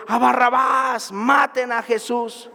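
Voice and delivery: slow, high mock crowd shout. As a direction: play the mob demanding Barabbas. Preacher voices shouting crowd.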